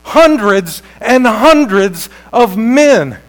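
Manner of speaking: loud, high, slow proclamation; stretched, ringing sermon climax; full-force preaching. Real, not acted. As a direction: thunder it out phrase by phrase. Preaches the soldiers falling down.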